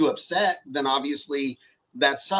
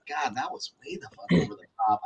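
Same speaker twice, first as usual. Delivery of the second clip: whispered to himself, ashamed; a hushed aside after blowing up; regretful whisper; 3 semitones lower, 5 dB quieter than usual. Retold or imitated. Regretting his own outburst.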